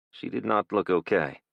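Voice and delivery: ordinary delivery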